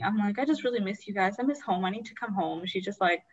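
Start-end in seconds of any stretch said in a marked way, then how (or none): none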